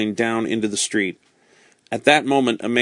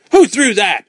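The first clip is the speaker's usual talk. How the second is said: much higher, loud outraged shout; 10 semitones higher, 7 dB louder than usual. Acted. Marquis shouting after the coin is thrown back. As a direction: bellow it at the crowd. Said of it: "shouted the Marquis".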